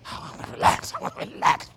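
breath-only plea of a worn-out dad; weary whisper. Acted. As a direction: whisper it like an exhausted parent. Worn-out dad answers kids.

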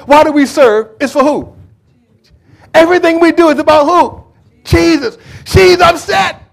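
high, loud call and response; preaching at full force. Acted. Preacher calling to the congregation, loud and high. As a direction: drive the congregation to answer Jesus.

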